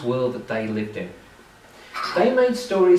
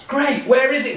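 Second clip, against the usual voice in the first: eager Thor; 11 semitones higher, 4 dB louder than usual. Acted.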